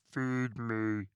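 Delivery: lightly voiced man-eating plant from a movie; a hint of a greedy plant demanding food; hungry plant monster. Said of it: "a plant that wants to eat people".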